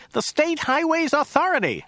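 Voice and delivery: high, puzzled repetition; a bit flummoxed. A narrator's reading. Driver repeating answer to puzzled passenger, high flummoxed voice. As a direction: repeat it high, slightly baffled. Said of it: "a bit flummoxed".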